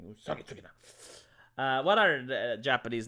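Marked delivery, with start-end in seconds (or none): 0.0-0.7 quiet. Shown as a demonstration. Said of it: "Usagi Tsukina is a lot better".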